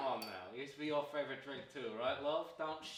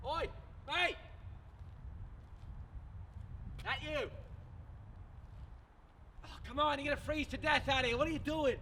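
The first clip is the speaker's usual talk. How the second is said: high, raised-voice hail; calling out; 12 semitones higher than usual. Acted. Man calling to a stranger freezing outside, shouted. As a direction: call across the cold at someone.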